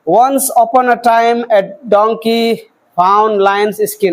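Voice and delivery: reads slowly, breaking after every few words; broken reading. Shown as a demonstration. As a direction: chop the sentence into halting pieces, slowly. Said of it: "इस तरह से नहीं पढ़ना है". (रुक-रुक कर धीरे-धीरे टूटा हुआ पढ़ना)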